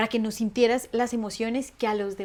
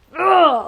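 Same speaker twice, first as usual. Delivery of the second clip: exasperated groan; 6 semitones higher, 11 dB louder than usual. Real, not acted. Fed up with calm-down advice.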